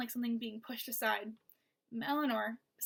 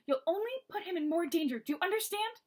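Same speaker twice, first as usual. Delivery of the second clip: sharp hissed rebuke, rising higher; hissed plea; 6 semitones higher, 3 dB louder than usual. Acted. Eleanor stopping Maggie from struggling, sharp hissed rebuke. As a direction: hiss at the struggling girl. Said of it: "Eleanor hissed".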